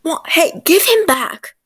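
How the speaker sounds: loud, frantic yell to get the toy back; yelling give it back; alarmed shout. Acted. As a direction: shout in a panic. Tommy grabs for his toy.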